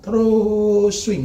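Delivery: high, loud, very slow stretch; drawn-out swing. Acted. Preacher stretching a word to show the swinging. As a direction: sing out the swing.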